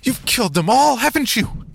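high, loud outraged accusation; accusing. Acted. Narrator accusing his brothers of the killings, outraged. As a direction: hurl it at them, appalled.

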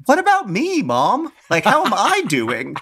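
high mock whine to Mom; whiny self-pity. Son complaining to Mom, high mock whine. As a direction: complain like the ignored main character. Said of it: "And I was like".

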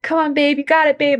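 high, encouraging feminine voice. Retold or imitated. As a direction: put on a high, girly climbing cheer. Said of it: "in this feminine voice".

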